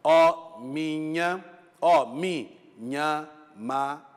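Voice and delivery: "Ó minha, ó minha, ma" scanned slowly, one metric beat at a time; slow syllable-by-syllable scansion; scanning syllables. Shown as a demonstration. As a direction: count out the poetic syllables slowly. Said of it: "na divisão silábica".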